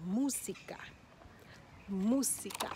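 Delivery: Música with weight on MÚ; teacher's model "Música", U stressed; stress on first syllable. Shown as a demonstration. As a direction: hit MÚ, keep "sica" light in Música. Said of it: "It's on the first syllable".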